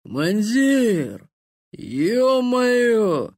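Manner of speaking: put-on slurred drunken shout, high; drunk slurring. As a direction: drunk soldier yelling with joy. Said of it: "заплетающимся языком вымолвил".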